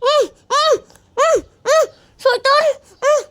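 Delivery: high, loud muffled struggle; gagged muffled cries. Acted. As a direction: cry out through a gag.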